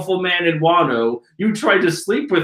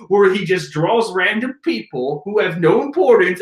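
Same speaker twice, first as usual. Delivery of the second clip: ordinary talk, nothing marked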